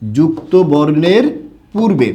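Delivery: যুক্ত বর্ণের পূর্বে said slowly, each word weighted; slow teacherly stress on যুক্ত বর্ণের পূর্বে; slow repeated emphasis. Shown as a demonstration. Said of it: "এটা মাথায় রাখতে হবে".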